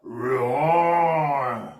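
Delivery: long drawn-out mock roar; lion roar. Shown as a demonstration. Showman leading children in animal impressions, playful lion roar. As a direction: roar like a lion, swelling in the middle. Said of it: "do an impression of a lion".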